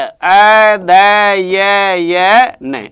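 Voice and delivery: अ, द, य, य, न said slowly one sound at a time; spelling out sounds. Shown as a demonstration. Teacher spelling अध्ययन out letter by letter. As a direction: break अध्ययन into separate sounds. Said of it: "ऐसे लिखेंगे अध्ययन".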